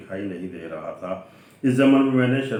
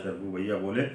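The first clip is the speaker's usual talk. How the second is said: plain reading voice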